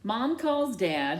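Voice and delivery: ordinary delivery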